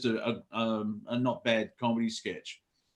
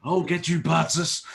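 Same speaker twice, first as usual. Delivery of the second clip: loud, laughing threat; hammed-up vow of revenge; laughing mock villain threat; 5 semitones higher, 7 dB louder than usual. Imagined vengeful threat.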